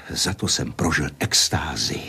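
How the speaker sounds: low, hushed confession; awed whisper. Acted. Man tells Marcela of his ecstasy, whispered. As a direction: whisper it like a sacred memory.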